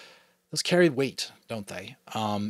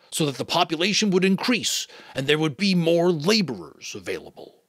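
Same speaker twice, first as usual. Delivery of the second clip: the giver, explaining; 4 semitones higher, 6 dB louder than usual. Acted. Giver figure speaks.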